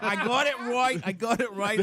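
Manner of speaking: put-on Boston accent. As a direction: insist in comic Boston-speak. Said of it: "gotta work at this accent".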